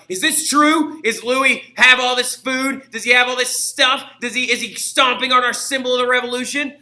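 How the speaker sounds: high, loud indignant demands; outraged fishwives. Acted. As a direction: rail against the king's feast. Outraged fishwives confronting the king.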